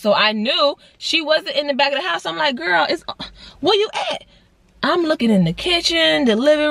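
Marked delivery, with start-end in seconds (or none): 2.5-4.2 high, impatient call to the cat; exasperated call. Retold or imitated. Searching for the cat.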